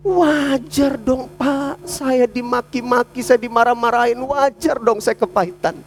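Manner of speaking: high, drawn-out whine of protest; bitter self-justifying complaint. Acted. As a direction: plead your grievance, stretching the words slowly. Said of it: "orang kepahitan, misalkan". (mengeluh pahit, membela diri)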